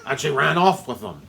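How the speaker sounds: loud, high put-on rage with laughs; hammed-up furious producer. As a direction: rant like an insulted movie mogul. Acted movie rant, very loud.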